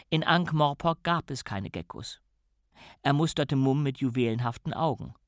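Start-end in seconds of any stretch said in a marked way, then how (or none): none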